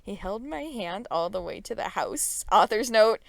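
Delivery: ordinary talk, nothing marked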